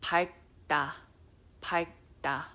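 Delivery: 밝다 with the L blocked by a back-tongue G stop; 밝다, tongue reaches for L then shuts it; Korean pronunciation. Shown as a demonstration. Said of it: "there's a word".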